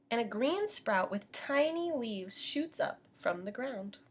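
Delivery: put-on lively storybook reading for little kids; bright teacher storytime voice; reading to children. A narrator's reading. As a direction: read it like storytime for preschoolers. Teacher reads picture book to class.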